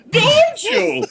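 cracking high laughing shriek; laughing shout. Acted. Climax of hammed line.

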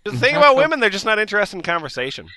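loud, high comedian bit, fast; stand-up comic. Acted. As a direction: launch a stand-up joke. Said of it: "your stand-up".

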